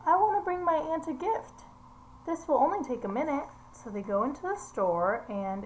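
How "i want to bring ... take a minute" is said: put-on raised, high, eager kid voice; child's voice. Teacher reading the story, voicing the girl asking to stop at the store, high and eager. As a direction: sound like a hopeful little girl.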